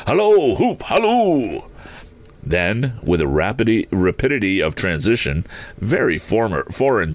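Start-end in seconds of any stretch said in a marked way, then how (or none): 0.1-1.6 high, drawn-out holler; gleeful high-pitched halloo; excited hallooing. Acted. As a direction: whoop it out as if hailing someone far off. Scrooge hails Friday running away.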